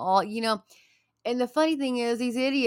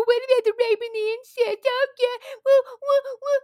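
high, mocking whiny voice of the other side; squeaky caricature of a critic, louder; mocking opponents; 5 dB louder than usual. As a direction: sneer at them in a silly high voice. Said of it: "There's people".

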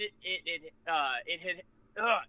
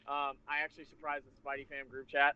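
ordinary talk

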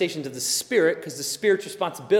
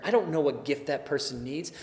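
usual speaking voice

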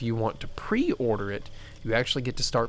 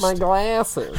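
whiny voice; 8 semitones higher, 4 dB louder than usual. Pretend. Mock whine about glasses.

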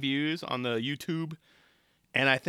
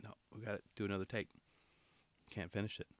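hushed imitating Tony; 4 semitones lower, 13 dB quieter, faster than usual. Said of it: "doing the cut it off gesture, like".

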